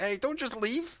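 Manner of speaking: high-pitched call after someone leaving; acted high, indignant bystander; calling out in protest. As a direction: pipe up high to stop them walking off. Voices bystander calling after departing player.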